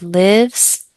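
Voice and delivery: lives, said slowly with a rising tune; teacher modelling the word lives for a student, slow and clear; slow stressed word. Shown as a demonstration. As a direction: stretch out lives for the learner. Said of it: "focus on these three words".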